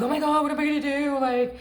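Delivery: high-pitched helpless wail, put on; hammed-up, high-voiced despair; mock overwhelmed panic. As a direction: imitate someone helpless begging to be rescued. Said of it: "so worked up and overwhelmed".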